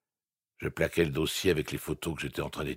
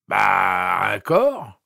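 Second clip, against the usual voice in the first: slurred voice; 4 semitones higher, 11 dB louder, much slower than usual. A narrator's reading. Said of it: "Voix pâteuse".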